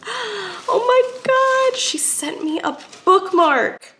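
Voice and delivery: gasp, then high, drawn-out amazement; overwhelmed gift reaction, slow and high; delighted astonishment. Real, not acted. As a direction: stretch it out in high-pitched delight. Unboxing a surprise gift.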